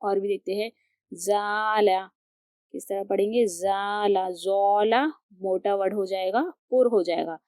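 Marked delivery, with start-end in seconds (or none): 1.1-2.0 ज़ाला with a thin, light "za". Shown as a demonstration. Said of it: "ज़ाला पढ़ना है, बारीक पढ़ना है". 3.5-4.3 ज़ाला with a thin, light "za". Shown as a demonstration. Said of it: "ज़ाला पढ़ना है, बारीक पढ़ना है".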